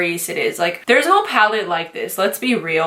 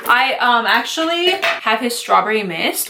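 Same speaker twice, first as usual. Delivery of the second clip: ordinary talk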